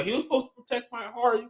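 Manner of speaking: lightly imitated tearful complaint; imitated crying plea. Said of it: "she was crying on the beat".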